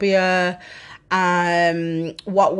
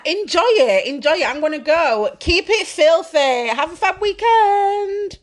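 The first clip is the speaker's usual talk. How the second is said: excited sign-off; 9 semitones higher, 5 dB louder than usual. Real, not acted. Signing off to listeners.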